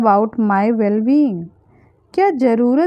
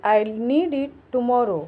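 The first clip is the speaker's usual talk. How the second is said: normal talking voice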